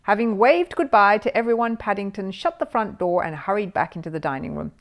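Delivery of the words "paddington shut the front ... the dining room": ordinary delivery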